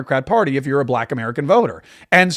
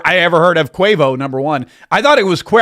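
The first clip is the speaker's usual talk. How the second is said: ordinary talk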